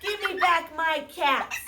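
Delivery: hammed-up cross peddler for the kids; angry peddler. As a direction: stamp your foot and demand them back. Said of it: "now he was very angry".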